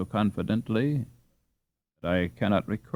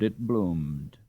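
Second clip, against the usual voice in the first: ordinary delivery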